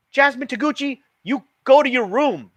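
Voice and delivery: high, loud full-name reprimand; stern scolding. A narrator's reading. Mother scolding her daughter in front of guest, loud and stern. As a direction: send her to her room sharply.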